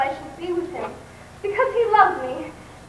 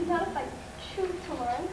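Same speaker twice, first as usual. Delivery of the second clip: much softer, teasing murmur; coy and soft; 11 dB quieter than usual. Acted. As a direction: tease him quietly. Coy reply to suitor.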